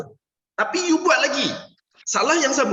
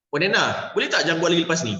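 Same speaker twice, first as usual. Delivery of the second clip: rushed nagging request; faster than usual. Shown as a demonstration. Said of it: "Situasi kedua".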